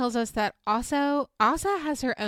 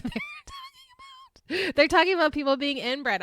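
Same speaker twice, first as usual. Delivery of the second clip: laughing in disbelief; 8 semitones higher, 3 dB louder than usual. Real, not acted. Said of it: "I'm laughing because it".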